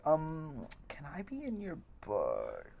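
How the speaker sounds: slow, very soft, hesitant request; hushed, drawn-out shy plea; timid deputy cop. Retold or imitated. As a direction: ask meekly, dragging out the words. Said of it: "the deputy cop is like".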